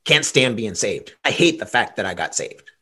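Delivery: low, grumbling mock complaint; mock grumbling convert. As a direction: sulk it out like a sour convert. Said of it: "gonna say to you".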